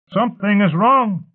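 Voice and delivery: high, loud alarmed shout. A narrator's reading. One of the elite to the storyteller, alarmed shouting. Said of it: "one of the elite shouted".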